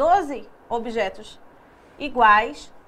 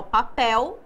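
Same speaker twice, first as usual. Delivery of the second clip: "papel" with "pel" pushed louder, rising at the end; stress on last syllable; 3 dB louder than usual. Shown as a demonstration. Teacher repeating the oxytone for pupils, "papel" with a loud "pel". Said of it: "a última sílaba é a mais forte".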